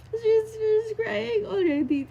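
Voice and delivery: high, slow, breaking voice; choked up remembering; crying. Real, not acted. Tearful retelling. (umiiyak at mabagal)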